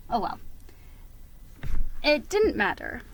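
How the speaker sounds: plain reading voice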